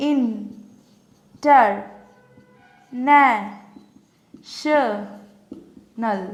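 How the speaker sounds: "International" very slowly, long pauses between five syllables. Shown as a demonstration. Teacher showing into how many parts it breaks, syllable by syllable. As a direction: isolate each syllable of "international". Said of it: "এবার এগুলোকে কি করে ভাঙা যায়".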